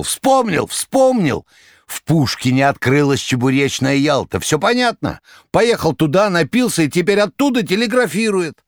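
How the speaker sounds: very high, loud eureka cry; excited shout. A narrator's reading. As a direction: leap up shouting the discovery. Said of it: "подпрыгнул и закричал".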